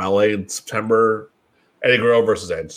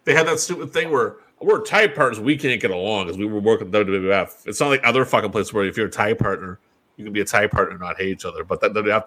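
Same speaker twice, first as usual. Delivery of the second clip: usual speaking voice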